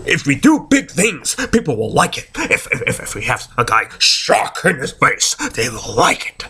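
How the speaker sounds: stammering, dim executive caricature; Vince McMahon. Acted. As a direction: play a clueless boss pitching his big idea. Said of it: "Like, Vince McMahon thinks".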